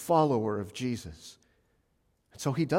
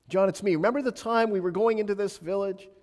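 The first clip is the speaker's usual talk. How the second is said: higher, louder buddy voice; mock chummy Jesus; 8 semitones higher, 4 dB louder than usual. A hammed-up Jesus reminiscing with John, raised and higher. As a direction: play it like an old pal catching up. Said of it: "That's not what he says".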